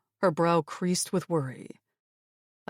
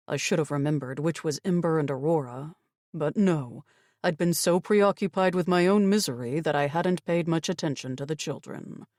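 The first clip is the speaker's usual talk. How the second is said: plain reading voice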